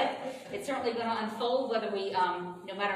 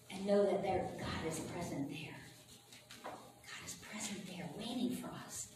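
guided meditation; 8 dB quieter than usual. Real, not acted. Minister guides congregation into inner peace, calm guided meditation. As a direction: soothe them inward, leaving long pauses.

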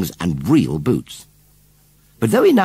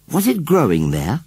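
higher-pitched curious question; 7 semitones higher, 3 dB louder than usual. A narrator's reading. Susan asks where the baby was found, curiously. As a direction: ask it with mild wonder. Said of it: "asked Susan curiously".

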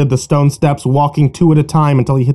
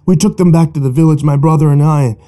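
feverish tale; 2 semitones higher than usual. Acted. Joe's feverish tale of gold.

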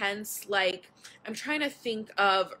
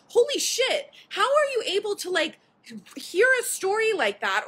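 much higher and louder, disbelieving; incredulous outburst; 12 semitones higher, 5 dB louder than usual. Real, not acted. Telling viewers about medical shows, high loud disbelief. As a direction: blurt it out in disbelief.

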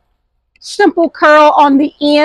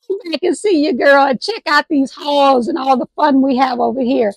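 ordinary talk, nothing marked

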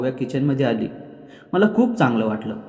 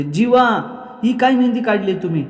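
high, loud startled outcry at the husband; loud cry; 7 semitones higher than usual. A narrator's reading. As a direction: shriek his name in dismay. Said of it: "खूप जोरात ओरडते". (जोरात ओरडणारी, उंच आवाजातली हाक)

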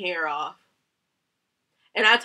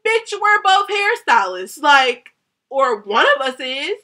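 high, loud, exasperated retort; indignant outburst; 5 semitones higher, 9 dB louder, slower than usual. Real, not acted. Hairstylist arguing with hairstylist over blame, loud indignant outburst. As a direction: snap back loudly, pitch jumping up. Angry retort to the stylist she argued with.

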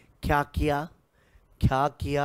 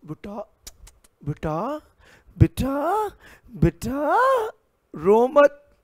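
pleading papa; 8 semitones higher, 4 dB louder, slower than usual. Acted. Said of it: "पापा ने".

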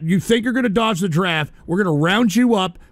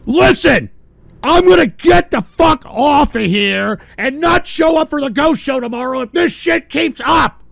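loud, higher raging shout; furious rant; 5 semitones higher, 4 dB louder than usual. Real, not acted. Streamer threatening to quit the show over trolls, furious shouting. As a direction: explode at the trolls, really furious.

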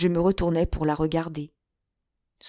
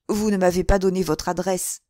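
plain reading voice

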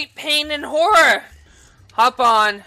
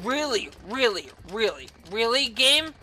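soft, repeated "really", lifting on "game"; subdued exasperated questioning; deadpan disbelief; 4 semitones lower, 6 dB quieter than usual. Real, not acted. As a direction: repeat it wearily, scolding the game.

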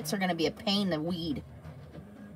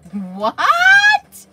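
much higher, louder squeal of disbelief; shocked what; 12 dB louder than usual. Real, not acted. Reading a surprising chat message, loud high shriek.

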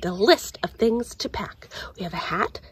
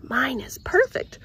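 usual speaking voice

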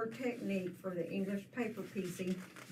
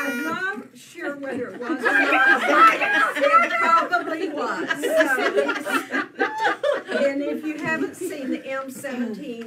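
laughing; 6 semitones higher, 12 dB louder than usual. Real, not acted. Still laughing after shriek.

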